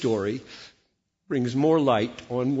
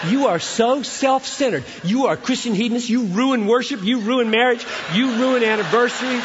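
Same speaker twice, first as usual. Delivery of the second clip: higher, louder hammed-up scolding; mock furious accusation; 11 semitones higher, 7 dB louder than usual. Imagined wife scolding her husband, hammed-up and loud. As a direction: rant at him in a shrill parody.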